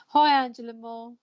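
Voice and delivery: ordinary talk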